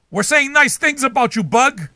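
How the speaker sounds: loud, high teasing call to a bug; yelling nice things at a bug; mock sweet yell. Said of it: "You yell at them".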